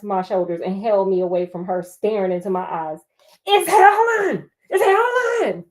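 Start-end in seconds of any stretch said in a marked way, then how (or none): 3.5-5.6 frantic cry. Acted. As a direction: plead the name like she's seen it. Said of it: "she said it twice".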